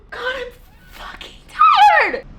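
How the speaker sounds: high, slow, loud cry of exhaustion; exhausted yell. Real, not acted. Worn out after hours of rearranging, shouting. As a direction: wail out how tired you are.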